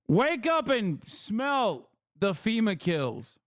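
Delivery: high, slow mock sermon; sarcastic preaching. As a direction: drag it out like a crazed truther. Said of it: "I'm being sarcastic".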